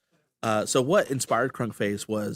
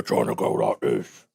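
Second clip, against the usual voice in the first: low orc voice; 13 semitones lower than usual. Shown as a demonstration. Mocking amateur orc voice actors, very low slow growl. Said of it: "that aren't voice actors".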